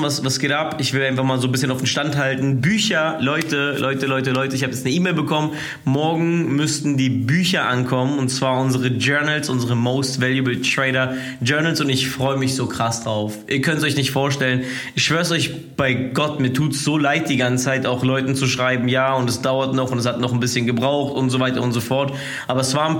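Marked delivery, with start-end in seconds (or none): none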